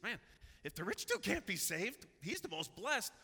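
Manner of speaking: high-pitched, incredulous mock protest; astonished disciples. Disciples ask Jesus who can be saved, astonished high voice. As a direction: question it in high, acted disbelief.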